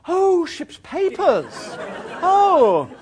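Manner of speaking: very high, loud, drawn-out oh; mock discovery. Traveller handing forged papers to official, mock surprised. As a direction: exclaim with exaggerated delighted surprise.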